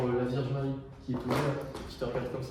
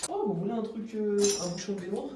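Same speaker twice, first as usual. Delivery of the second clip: mock salesman; 6 semitones higher than usual. Playing a street seller.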